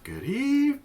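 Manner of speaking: high yawning greeting trailing into a sigh; mock-sleepy, stretched out, sighing away; really tired. Shown as a demonstration. Teacher has kids echo a tired good evening, yawning. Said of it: "let's say it like we're really tired".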